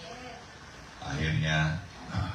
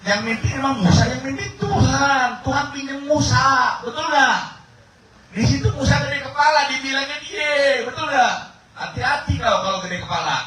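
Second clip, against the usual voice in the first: high, loud fiery preaching; full-force preaching; 5 dB louder than usual. Acted. Preacher thunders at the congregation about Moses, fiery.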